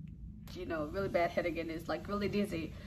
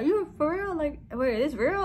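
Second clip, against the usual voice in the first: high, louder mock disbelief; imitated shock; 9 semitones higher, 5 dB louder than usual. Husband questioning his wife's news, in imitated shock. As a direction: act out a husband who can't believe it. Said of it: "he was just so shocked".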